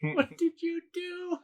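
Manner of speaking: high, slow mock wail; mock anguished scream. Replaying the strangling scene, drawn-out mock anguish. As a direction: stretch it out way up high.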